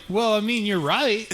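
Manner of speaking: high, loud mock agreement; sarcastic. Barista sarcastically agreeing with police, high and loud.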